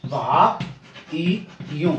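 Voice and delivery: वा ई यू as "bhaa, ee, yon" with pauses; teacher spelling out वा ई यू; syllable-by-syllable demo. Shown as a demonstration. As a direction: sound out वा ई यू piece by piece. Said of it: "कई वायु ऐसे लिखती हैं".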